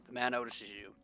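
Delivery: ordinary delivery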